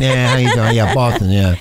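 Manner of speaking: put-on low, jokey Boston accent. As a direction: ham up a Boston greeting. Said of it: "gives you a Boston accent".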